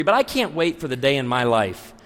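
normal talking voice

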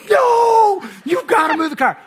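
hammed-up desperate shout; acted yelled protest. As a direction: cry out in comic horror. Pastor acts out protest.

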